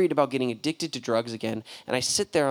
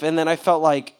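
usual speaking voice

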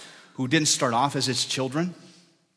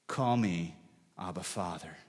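soft, fading to a hush; tender invitation; 5 semitones lower, 7 dB quieter than usual. Acted. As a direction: invite gently and let it fade.

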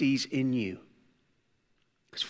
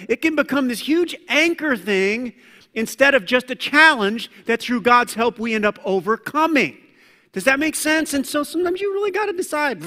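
higher, louder fervent preaching; preaching at full force; 8 semitones higher, 5 dB louder than usual. Real, not acted. Preacher urging the congregation to resist, loud and fervent. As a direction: keep the fire going.

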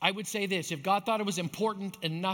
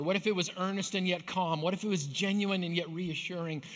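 usual speaking voice

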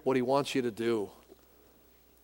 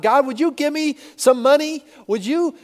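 much higher, louder, whiny begging to God; mock whiny petitioner; 9 semitones higher, 6 dB louder than usual. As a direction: mimic a needy, grabby prayer. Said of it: "your first response is not".

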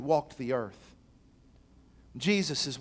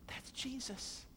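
hushed awe; 8 semitones higher, 16 dB quieter, slower than usual. Real, not acted.

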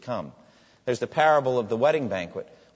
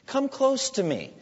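pleading call; 11 semitones higher, slower than usual. Acted. God's call voiced by preacher.

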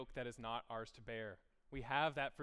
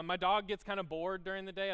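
ordinary talk, nothing marked

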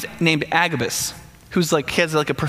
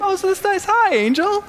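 much higher, airy dream-talk; a half-asleep man greeting an angel, pitched way up; dreamy Peter; 12 semitones higher than usual. Retold or imitated. Peter, sure it's a dream, greets the angel in a high voice.

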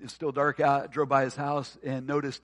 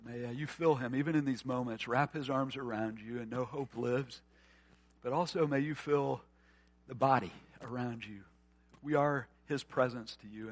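tender prayer. Acted. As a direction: pray gently over them. Pastor prays over grieving listeners.

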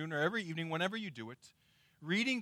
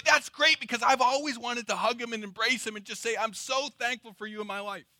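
higher-pitched mock-sentimental gush; gushing gratitude; 6 semitones higher than usual. Believer imagines hugging Jesus, gushing thankfulness. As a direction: gush it out in one eager run. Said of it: "some of us would say".

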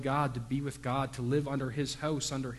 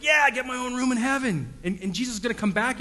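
put-on much higher, louder kid voice; excited kid; 10 semitones higher, 6 dB louder than usual. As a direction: squeal it like a thrilled little boy. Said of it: "They're like".

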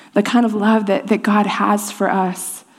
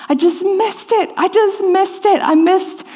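much higher, anguished "I missed it"; dismayed realization; 9 semitones higher, slower than usual. Retold or imitated. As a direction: panic as it dawns on you. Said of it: "And I thought".

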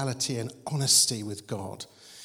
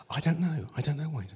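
usual speaking voice

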